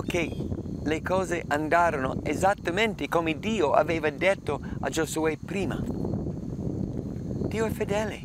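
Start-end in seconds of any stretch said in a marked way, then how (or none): 5.8-8.2 slow, breathy higher whisper; hushed whisper. Real, not acted. Preacher affirming God's faithfulness to the congregation, slow hushed whisper. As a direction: whisper it slowly, like a confession of faith.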